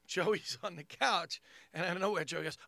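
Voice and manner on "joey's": ordinary talk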